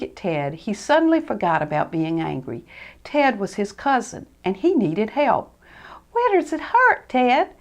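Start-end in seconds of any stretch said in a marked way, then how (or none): 6.1-7.5 concerned. Acted.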